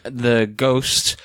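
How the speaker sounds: usual speaking voice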